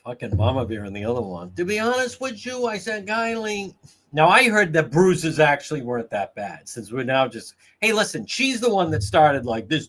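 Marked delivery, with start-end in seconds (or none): 1.5-3.7 high mocking voice; mocking imitation. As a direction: mimic it high, stretching the name.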